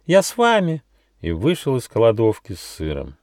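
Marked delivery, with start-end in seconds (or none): 0.1-0.8 high little mouse squeak of a voice; mouse babble. A narrator's reading. Mouse hurries to rejoin his friends, babbling. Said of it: "Мышонок пролепетал".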